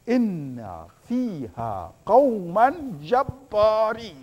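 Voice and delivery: slow recited Arabic verse; preacher quoting the Quran in slow recitation; Quranic recitation. Shown as a demonstration. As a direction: chant the verse slowly and reverently. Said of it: "what did the people say in the Quran".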